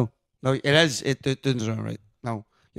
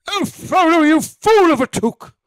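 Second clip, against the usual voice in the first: loud, high, strained wizard cry; hammed-up, gravelly wizard rebuke; Gandalf; 11 semitones higher, 7 dB louder than usual. Shown as a demonstration. Trying Ian McKellen's wizard for co-hosts, loud high imitation. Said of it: "Ian McGandalf".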